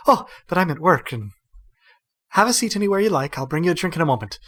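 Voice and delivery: fast, bright, bustling; hurried back to work. Acted. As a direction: rattle it off like a busy barmaid. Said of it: "is like".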